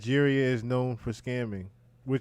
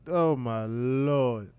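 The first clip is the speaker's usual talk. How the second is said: long drawn-out, weary exclamation; exasperated disbelief; much slower than usual. Real, not acted. Commentator reacting to a faked kidnapping, exasperated disbelief. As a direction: draw it out slowly in dismay.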